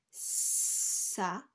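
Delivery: "Sablier" as sssa... bli... yé, first s held long; stretched initial S. Shown as a demonstration. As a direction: hiss the opening s of "Sablier", then separate each syllable. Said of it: "J'entends le S dans la première syllabe". (« Sablier » découpé, avec le s initial très allongé)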